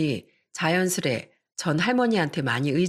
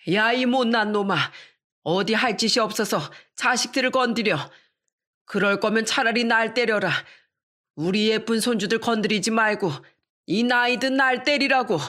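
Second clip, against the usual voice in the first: grandmother scolding; 5 semitones higher than usual. Acted. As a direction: scold your grown son like a fierce old grandma. Grandmother shields kids, scolds son. (아들을 꾸짖는 할머니의 높고 큰 목소리)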